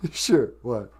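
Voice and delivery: ordinary delivery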